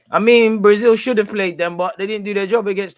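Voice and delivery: high, loud burst of protest; loud outburst. Real, not acted. Arguing Brazil should have played, loud burst. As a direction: blurt it out hard.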